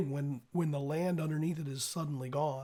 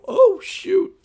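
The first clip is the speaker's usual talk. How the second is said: high, loud yelp of alarm; startled cry; 16 dB louder than usual. Acted. Game master acts out a player sliding down, startled cry. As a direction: cry out as you lose your footing. Acting out the slide's mishap.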